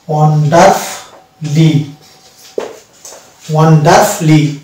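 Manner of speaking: Wonderfully said very slowly as won-dar-fli; slow model pronunciation. Shown as a demonstration. Teacher modelling Wonderfully slowly for students. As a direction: draw out Wonderfully, dropping the u. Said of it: "it will be wonderfully".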